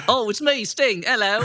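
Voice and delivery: high-pitched mock British rocker; hammed-up British celebrity impression. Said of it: "That's not your best [name] impression".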